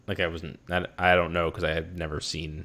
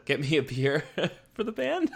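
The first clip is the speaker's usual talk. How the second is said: laughing; 12 semitones higher than usual. Pretend. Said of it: "I'm gonna use that on you".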